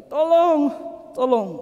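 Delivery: high, loud shout for help; exhausted man's loud cry for help; cry for help again. Acted. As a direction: call out from the pit. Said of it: "Dia teriak lagi".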